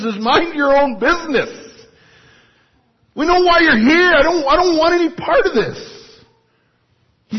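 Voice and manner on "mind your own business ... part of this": much higher, loud demon snarl; angry demon. Retold or imitated. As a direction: shout it back at Jesus. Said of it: "the demon is saying".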